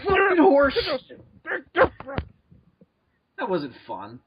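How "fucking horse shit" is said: angry shout. Real, not acted. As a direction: spit it out in real anger. Angry after wrong answer.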